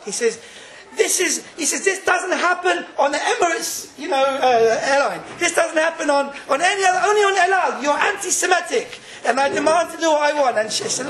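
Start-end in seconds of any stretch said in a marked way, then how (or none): 0.9-1.4 high, indignant outburst; indignant protest. Retold or imitated. Passenger objecting to El Al crew, indignant. 1.8-10.6 high-pitched mock tirade, rising in force; indignant protest. Passenger telling off El Al air hostess, indignant.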